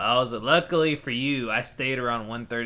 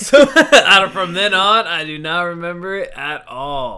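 talking through a big burst of laughter, much louder and higher; laughing; 8 semitones higher, 15 dB louder than usual. Real, not acted. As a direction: laugh it out while telling.

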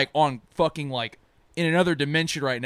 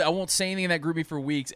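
ordinary talk, nothing marked

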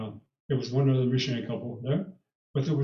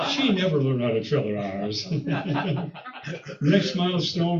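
ordinary talk, nothing marked

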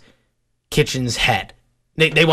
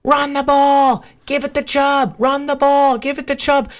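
much higher mock shout at the field; imitating a yelling teen fan; 12 semitones higher than usual. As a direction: ham up a young fan bellowing plays from the stands. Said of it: "is yelling the whole time".

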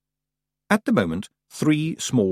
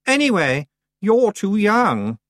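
high, very slow, condescending; dismissive; 12 semitones higher, 4 dB louder, slower than usual. A narrator's reading. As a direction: brush her off. Anagrama dismissive.

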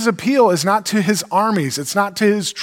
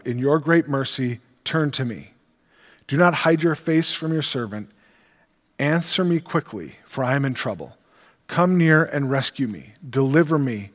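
scripture reading; 5 semitones lower than usual. Acted. Reads psalm aloud.

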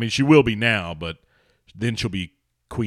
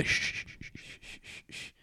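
put-on whispered dad shushing kid; 15 dB quieter than usual. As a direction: hiss shh to silence a child. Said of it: "Why are you shushing me?".

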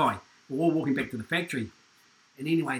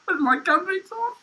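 usual speaking voice

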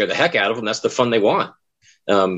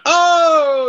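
mock group gasp; 5 dB louder than usual. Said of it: "his whole group just went".

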